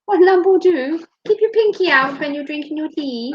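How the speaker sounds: high mock-posh tea talk; hammed-up posh lady; posh hostess. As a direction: play the fussy English hostess. Posh tea role-play.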